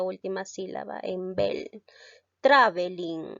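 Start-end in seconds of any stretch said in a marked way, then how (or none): 2.4-3.3 "Traveling" hit hard on the first syllable, TRA-ve-ling; stress on TRA. Shown as a demonstration. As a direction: land the weight on the TRA of "Traveling". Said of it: "recae en la sílaba tra". ("Traveling" con fuerza de voz en TRA)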